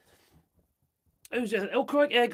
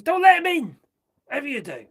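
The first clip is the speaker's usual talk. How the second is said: loud, higher urgent warning; emphatic warning; 6 semitones higher, 10 dB louder, faster than usual. Real, not acted. Urging American viewers, a loud warning. Advising Americans against door-knockers.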